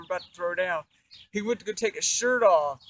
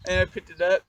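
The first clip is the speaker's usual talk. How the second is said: ordinary talk, nothing marked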